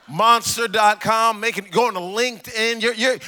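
ordinary talk

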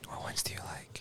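soft unvoiced whisper. Real, not acted. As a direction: whisper it, barely breathed. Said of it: "we're whispering into the mics".

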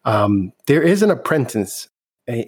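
ordinary delivery

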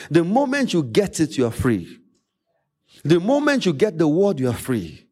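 ordinary talk, nothing marked

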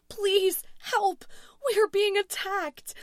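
high, loud shaking plea; terrified scream. A narrator's reading. Louise calling emergency services under attack, screaming. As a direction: scream for help in sheer panic. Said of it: "voice was shaking as she screamed".

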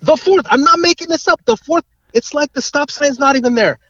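can't believe it, pitch way up; excited disbelief. Real, not acted. Cars blowing stop sign, outraged.

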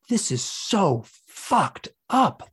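high, very slow anguished wail, no real tears; imitated sobbing outburst. Hypnosis client re-enacting sobbing at the beings, drawn-out anguished wail. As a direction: act out an overwrought cry, stretching each word. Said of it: "I'm sobbing and I'm going".

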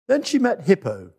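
plain reading voice

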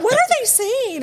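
high-pitched exasperated wondering; baffled. Retold or imitated. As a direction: replay your bewilderment, voice jumping up high. Retelling her baffled thought.

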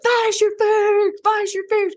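high, slow, drill-sergeant chant; bully her through it in a squeaky mock cheer; pushy coach mom. Deb grabbing Lauren to toughen her up, high mock chant. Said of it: "And Deb was like".

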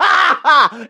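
a burst of high, loud laughter. Real, not acted. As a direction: crack up at your own line. Laughs at his own joke.

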